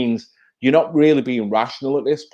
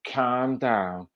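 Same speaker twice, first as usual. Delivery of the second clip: lightly imitated soothing, lower voice; mock calming; 5 semitones lower, 5 dB quieter, much slower than usual. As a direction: imitate someone patronisingly settling a person down. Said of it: "Look at him with his hand up".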